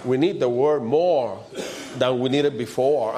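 usual speaking voice

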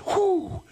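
high, loud spirited whoop; joyful whoop. Retold or imitated. Prophet overflowing with joy, high whooping cry. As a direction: let out a happy holy whoop. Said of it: "I can hear that prophet say".

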